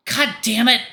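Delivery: high, loud self-directed yell; yells into the air. Acted. Artemis cursing at himself, loud high yell. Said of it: "He yells into the air".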